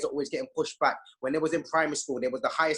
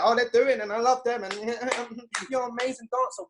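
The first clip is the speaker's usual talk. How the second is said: mock gushing praise; 7 semitones higher than usual. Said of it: "we're like".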